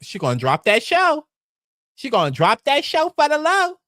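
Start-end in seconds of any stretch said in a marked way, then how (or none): 1.9-3.8 higher and louder, relishing it; gleeful emphatic retake, higher and louder; emphatic repeat. Shown as a demonstration.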